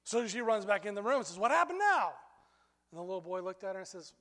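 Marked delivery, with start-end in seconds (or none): 1.4-2.2 higher, louder, alarmed mother bursting in; exasperated mom. Retold or imitated. Mom bursts back in on the screaming kids, exasperated and loud. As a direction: demand it of the kids as you rush back.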